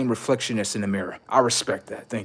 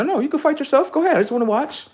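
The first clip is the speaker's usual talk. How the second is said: mock taunt; 12 semitones higher, faster than usual. Mocking a man fighting himself.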